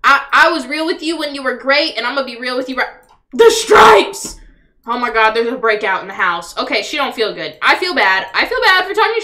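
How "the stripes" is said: startled exclamation. Real, not acted. Sudden sight of sick Sim.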